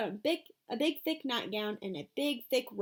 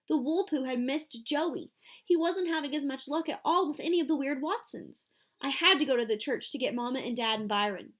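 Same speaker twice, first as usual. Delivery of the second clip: ordinary delivery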